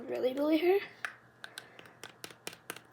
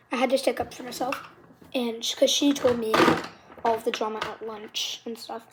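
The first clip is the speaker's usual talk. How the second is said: ordinary talk, nothing marked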